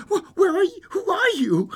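put-on high, stammering childlike question; high-pitched girl, tripping over her question; little girl. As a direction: pipe up like a puzzled little girl.

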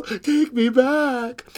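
high, slow, loud pleading; little girl crying out. A narrator's reading. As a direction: drag out the plea like a child. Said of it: "cried the little girl".

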